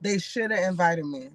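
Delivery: usual speaking voice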